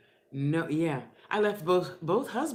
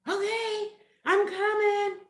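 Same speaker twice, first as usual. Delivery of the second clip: much higher, louder call from far off; 6 dB louder, slower than usual. Retold or imitated. Child answering mother from the clothesline, calling out loud. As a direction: shout back across the yard.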